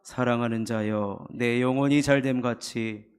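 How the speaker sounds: reading the verse aloud, loud and firm; loud scripture reading. Real, not acted. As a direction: proclaim the verse together. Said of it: "우리 한번 읽어보도록 하겠습니다". (큰 소리로 성경 봉독)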